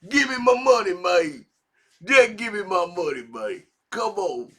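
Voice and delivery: loud, whiny demand, half-hammed; mock yell. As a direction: shout it like a nagging artist wanting his cash. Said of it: "screaming about publishing".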